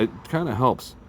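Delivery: ordinary talk, nothing marked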